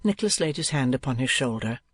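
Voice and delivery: ordinary delivery, nothing marked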